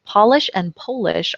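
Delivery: POLISH then POLISH, lowercase polish with an ah sound, then Polish with an oh sound; two pronunciations. Shown as a demonstration. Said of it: "spelled exactly the same way".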